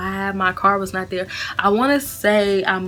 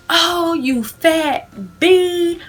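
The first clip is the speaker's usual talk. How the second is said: higher-pitched imitation of a taunting rival; mock threat; 7 semitones higher than usual. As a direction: mimic her threat in a raised, put-on voice.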